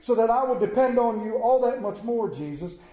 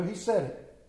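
ordinary talk

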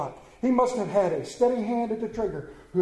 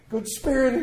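pleading; 5 semitones higher than usual. A narrator's reading. Scrooge begs on the ground.

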